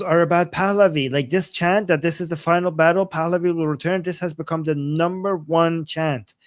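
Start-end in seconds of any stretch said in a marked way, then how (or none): none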